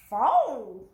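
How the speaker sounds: loud, odd doggy bark-like word; dog skeleton voice. Acted. Dog skeleton answering in a loud doggy voice. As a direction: bark it like a dog trying to talk. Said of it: "said the dog skeleton".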